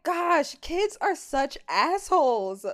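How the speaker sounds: mock exasperation. Watching the tantrum, mock exasperated inner voice. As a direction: roll your eyes at the kids. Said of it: "I'm like".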